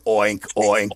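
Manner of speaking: low playful oink oink; low piggy grunt. Acted. Host voices the pig for the kids, low.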